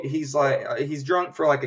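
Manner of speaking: usual speaking voice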